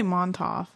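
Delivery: "Montauk" said as MON-toff. Shown as a demonstration. Co-host giving own pronunciation, "Montauk" ending in F. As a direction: finish "Montauk" on an F instead of K. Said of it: "I say Montauk".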